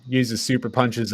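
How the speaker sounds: ordinary talk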